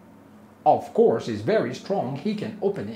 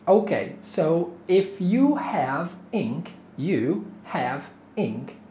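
slow teacher pace; 2 semitones higher than usual. Shown as a demonstration. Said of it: "teacher slows for learners".

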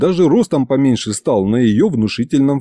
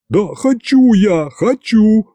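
shrill squeal; 10 semitones higher, slower than usual. A narrator's reading. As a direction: squeal it out in panic. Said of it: "снова заверещал Иван".

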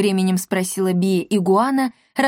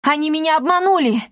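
high-pitched, agitated exclamation; breathless outburst; 7 semitones higher, faster than usual. A narrator's reading. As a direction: blurt out the news after running. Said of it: "выдохнул он". (взволнованно, на выдохе)

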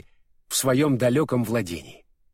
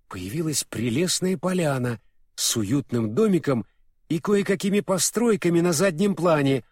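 plain reading voice

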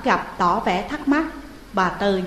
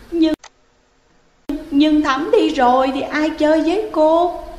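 high, mildly teary hesitant question; falter, on the edge of tears; 7 semitones higher, 5 dB louder than usual. Acted. Ghost Hiền teary, asking who will play with her. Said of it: "Hiền rưng rưng".

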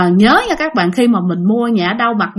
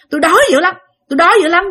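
mock hungry ghost; 8 semitones higher, 4 dB louder, much faster than usual. Hungry ghost voiced pleading.

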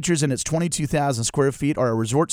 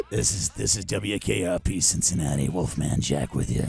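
much lower, softer late-night DJ voice; Wolfman Jack radio DJ; 11 semitones lower, 8 dB quieter than usual. Pretend. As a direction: drop into a deep radio DJ rumble. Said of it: "I can do something with that".

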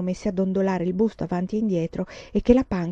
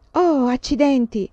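slow, loud, higher exclamation; annoyed surprise; 6 semitones higher, 6 dB louder, slower than usual. Acted. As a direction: cry out as the hem catches. Skirt caught on coal bucket.

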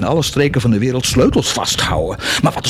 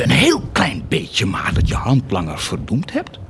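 ordinary talk, nothing marked

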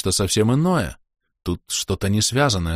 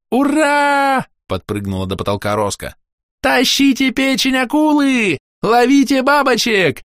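joyful shouting; 5 dB louder, slower than usual. Acted. Роска радостно прыгает.

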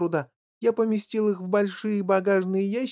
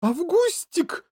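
high, drawn-out motherly cry; sudden wail; 13 semitones higher, slower than usual. A narrator's reading. Mother spotting her son by the river, sudden wail. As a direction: wail the pet name in alarm. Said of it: "завопила вдруг миссис Глуп".

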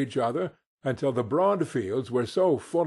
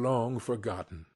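ordinary delivery, nothing marked

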